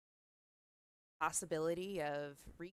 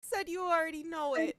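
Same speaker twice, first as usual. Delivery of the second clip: put-on high, loud little girl's voice; a scared child blurting it out; terrified little girl, voice way up and loud; frightened child; 12 semitones higher, 7 dB louder than usual. Frightened child repeats bad man.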